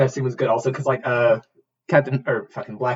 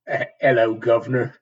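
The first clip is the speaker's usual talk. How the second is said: tentative, bad English accent; attempted English accent; 2 semitones lower than usual. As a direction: try an English greeting, hesitantly. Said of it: "trying to do an English accent".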